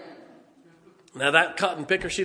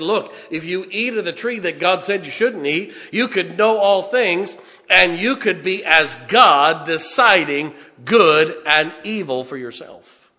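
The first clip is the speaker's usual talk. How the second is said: slow, building pulpit delivery; forceful preaching; 5 dB louder, slower than usual. Acted. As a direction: preach it loud, stretching the key words.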